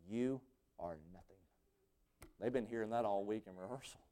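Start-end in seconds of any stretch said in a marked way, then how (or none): 0.1-1.4 softer and lower, dramatic pause after You; dropped to a hush. Retold or imitated. Conductor telling his musicians they are nothing, hushed. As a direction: deliver a cold quiet verdict. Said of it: "he looks at them and he says".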